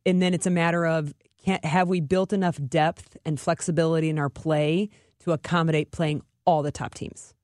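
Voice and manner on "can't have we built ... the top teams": normal talking voice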